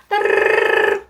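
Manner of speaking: rolled-tongue telephone trill; mouth-made ringtone; imitated phone ring.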